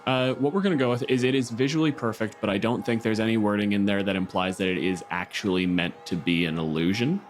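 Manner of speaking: ordinary delivery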